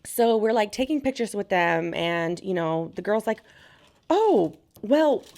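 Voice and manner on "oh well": high, louder mock stammer; hesitant showgirl. Showgirl caught out about the photographer, high mock voice.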